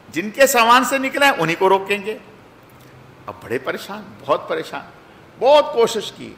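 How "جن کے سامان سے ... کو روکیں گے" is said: higher, louder, unbending reply; firm insistence. Retold or imitated. Officials refuse the brothers' plea, firm loud insistence. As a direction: lay down the rule, no arguing.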